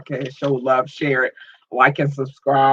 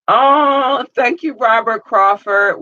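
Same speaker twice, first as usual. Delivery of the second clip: excited thanks; 8 semitones higher, 6 dB louder, slower than usual. Real, not acted. Thanking a supporter live.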